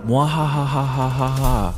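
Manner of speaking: slow evil cackle; villain mwahaha laugh. Acted. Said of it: "did you add the mwahaha".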